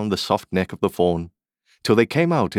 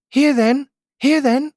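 very high, louder sing-out call; coaxing an animal; 6 dB louder than usual. Acted. Alice holding out her hand to the fawn, coaxing. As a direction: call it over in a high little voice.

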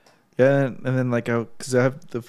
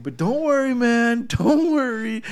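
high, mock-soothing hippie chick; hippie girl voice; 11 semitones higher, 4 dB louder, slower than usual. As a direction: coo it like a laid-back hippie girl, pitched way up. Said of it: "'Cause she's super hippie".

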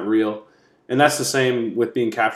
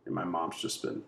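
much softer, a stunned near-whisper of realisation; hushed horror; 4 semitones lower, 13 dB quieter than usual. Retold or imitated. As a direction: let it fall away, a boy who can't believe it. Voicing JoJo seeing his hanged mother.

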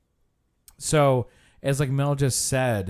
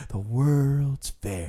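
very slow, drawn-out mock guide; imitating a guide running out the clock; tour guide's delivery; much slower than usual. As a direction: stretch each word to fill time. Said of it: "this is how he talked".

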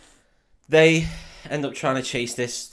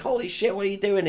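shocked exclaim; 8 semitones higher than usual. Retold or imitated. Mario shocked at eye-thumbing.

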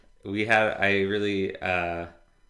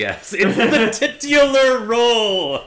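laughing; 14 dB louder, slower than usual. Real, not acted. Laughing at favorite movie line.

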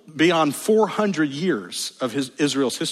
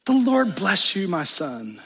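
higher, lightly mocking doting mother; bizarrely sweet mother; 4 semitones higher than usual. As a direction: mimic her odd blessing. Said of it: "the mother responds in a bizarre way".

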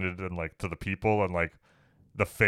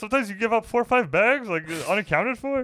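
mock boss voice; 10 semitones higher, 5 dB louder than usual. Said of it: "He's like".

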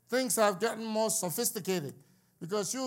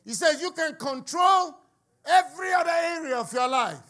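loud, high and emphatic preacher delivery; full-force preaching; 6 semitones higher, 8 dB louder than usual. Acted. Preacher expounding scripture to the congregation, full force. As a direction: drive each phrase out loud, building to a shout.

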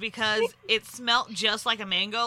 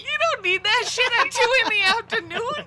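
words bursting through laughter; laughing hard while talking; 9 semitones higher, 6 dB louder than usual. Real, not acted. Cracking up at friends' fire.

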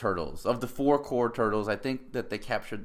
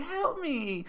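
put-on much higher, thin pleading girl; mock whiny girl. Mocking the helpless girl hiding behind the truck, high whimper. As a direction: squeak it like a helpless crying girl. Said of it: "she's just crying".

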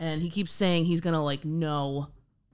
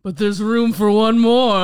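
dark lord; 3 semitones higher, 11 dB louder than usual. Retold or imitated. Quoting the Haunted Mansion ghost host.

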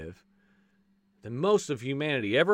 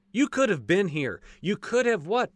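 ordinary delivery

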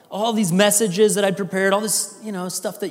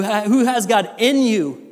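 ordinary delivery

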